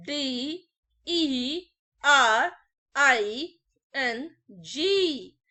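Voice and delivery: dictating the spelling letter by letter, drawn out; teacher spelling the word very slowly for students. Shown as a demonstration. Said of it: "तो मैंने कहा".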